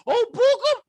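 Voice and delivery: squeaky high mock cry of the name; mock high call. Mocking a bishop, high squeaky call. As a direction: pitch the name way up in mockery.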